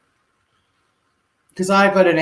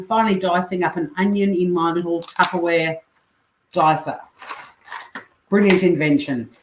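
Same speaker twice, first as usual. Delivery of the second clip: ordinary talk, nothing marked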